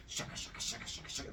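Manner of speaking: rapid unvoiced "shaka" rhythm; hushed shuttle sound effect, no voice; imitated loom noise. Storyteller mimics two weavers racing, imitated loom noise. Imitates frantic weaving at looms.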